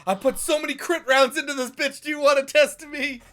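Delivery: high, loud cocky brag; cocky gamer. Retold or imitated. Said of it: "he's like".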